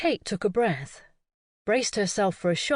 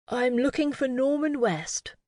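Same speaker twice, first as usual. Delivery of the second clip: put-on upper-class accent; 4 semitones higher than usual. A narrator's reading. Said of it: "in an upper-class accent".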